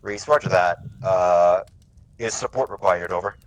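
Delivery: loud, clipped-free, robotic radio procedure; terse radio reply. Shown as a demonstration. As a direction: speak like a robot on the net. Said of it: "How Reese would reply to me is".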